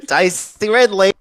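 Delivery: high-pitched Australian twang; Aussie accent. Shown as a demonstration. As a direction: show off a playful Aussie accent. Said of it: "wants me to do an Aussie accent".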